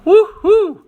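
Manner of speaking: high, loud joyful whoop; a sudden thrilled woo-hoo; excited whoop. Real, not acted. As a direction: cheer at the finished shell. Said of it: "I'm getting emotional here".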